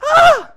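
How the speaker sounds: sudden high crowd gasp; mock shocked cry of students; students' gasp. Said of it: "all the students went".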